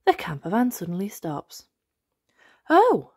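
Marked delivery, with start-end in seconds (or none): 2.7-3.1 high, loud startled oh; loud, higher oh; surprised oh. Acted. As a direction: caught out dad. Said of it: "says Daddy Pig".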